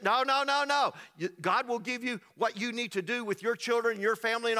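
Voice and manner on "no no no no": high, loud repeated refusal; emphatic no. Real, not acted. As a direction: shout down each no.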